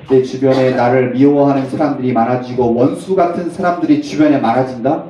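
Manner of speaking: usual speaking voice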